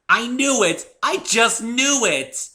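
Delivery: high, loud, gleeful shriek; a thrilled pony squealing her win; shrieking with joy. A narrator's reading. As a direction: shriek it out in triumph. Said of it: "she was shrieking".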